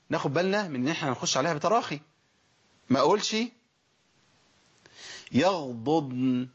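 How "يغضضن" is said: يغضضن with both ḍāds held firm and slow; correct double ḍād. Shown as a demonstration. Teacher modelling the right doubled ḍād. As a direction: draw يغضضن out with two clear ḍāds. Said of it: "ده الصح".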